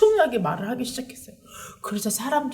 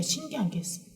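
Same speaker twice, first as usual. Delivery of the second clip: ordinary talk, nothing marked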